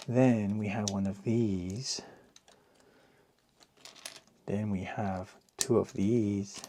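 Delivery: soft mock Mexican accent; quiet fake Mexican accent; cod Mexican accent. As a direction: murmur it in a hammed-up Mexican accent. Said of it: "uh, cod accent".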